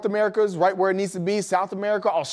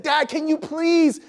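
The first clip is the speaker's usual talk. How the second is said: put-on slow, higher begging kid voice; pleading child; 6 semitones higher, slower than usual. Son pleads with busy dad, slow whiny beg. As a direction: drag out the please like a persistent kid. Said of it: "kids are persistent".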